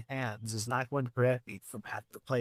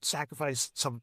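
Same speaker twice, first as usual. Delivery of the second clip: ordinary talk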